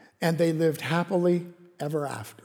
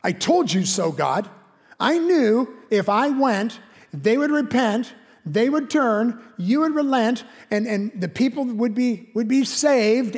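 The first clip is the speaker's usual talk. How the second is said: higher, louder told-you-so complaint; mock indignant Jonah; 5 semitones higher, 4 dB louder than usual. Jonah complaining to God, higher and louder indignation. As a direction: whine it at God like a sulking prophet. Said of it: "it's a kind of a".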